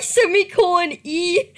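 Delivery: normal talking voice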